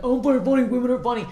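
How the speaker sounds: high, loud parody of a complainer; mocking imitation. Comic mimicking people who dismiss women comics, mocking. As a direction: pitch it up and jeer the opinion. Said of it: "and you're like".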